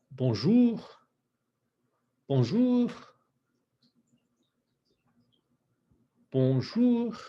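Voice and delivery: Bonjour with lips rounded into an O. Shown as a demonstration. Teacher models Bonjour for class, lips rounded into an O. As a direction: shape an O on Bonjour. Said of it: "we're gonna make an O".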